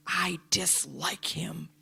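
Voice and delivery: hushed, slow, under the breath; a secret grudge she won't say aloud; whispered resentment. Retold or imitated. Silent resentment in back seat.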